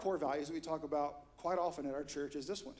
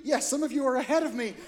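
ordinary talk, nothing marked

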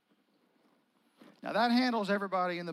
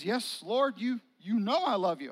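louder and higher, pressing the point; insist, a little exasperated; repeating himself with a push; insistent reply; 3 semitones higher, 6 dB louder than usual. Acted. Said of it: "I just said that".

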